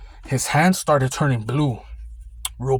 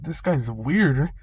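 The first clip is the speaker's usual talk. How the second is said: slowed-down, drawn-out aside; slow wary remark; much slower than usual. Retold or imitated. Guard privately judges the odd customer, slow and wary. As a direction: say it slowly, sizing him up. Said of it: "I was like".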